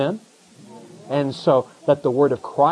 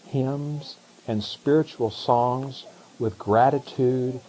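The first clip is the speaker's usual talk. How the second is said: slow, hushed scripture reading; 3 semitones lower, 4 dB quieter, much slower than usual. Acted. Pastor reads the verse to the church, slow and hushed. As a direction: read the verse to the congregation slowly and softly.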